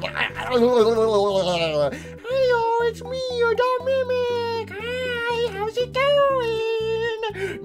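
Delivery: very high, drawn-out dopey greeting; silly small mimic. Gamer voicing dumb shrunken Mimic greeting, very high dopey squeak. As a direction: squeak it like a goofy tiny creature.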